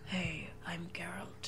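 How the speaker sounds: low, slow husky whisper; sexy Geralt voice. Retold or imitated. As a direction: whisper it like a gruff seducer. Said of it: "Geralt has a sexy voice".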